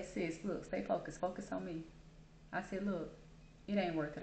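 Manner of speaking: quietly steady the cashier; hushed calming counsel; retold quiet advice.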